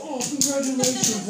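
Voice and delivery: high, loud mock "congratulations" redone; imitating jealous guy. Preacher re-enacting the homeless man, high and mocking. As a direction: replay his sneering congratulations. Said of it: "like that".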